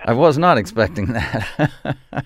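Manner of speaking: slow, laughing through the words. Real, not acted. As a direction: chuckle as you say it. Laughing after the surprise buzz.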